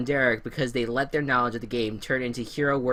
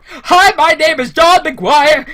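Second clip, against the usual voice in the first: loud, high, drawn-out mock yell; mock scream; 14 semitones higher, 14 dB louder, slower than usual. Imitating the contestant screaming his hello in the interview. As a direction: scream the greeting like an overhyped contestant. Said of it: "And he's, like, screaming".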